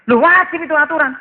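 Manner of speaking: bentakan tiruan polisi, tinggi dan lantang; polisi membentak. Acted. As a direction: tegaskan aturan seperti polisi kesal. Polisi membantah pelanggar. (memerankan polisi, suara melonjak keras)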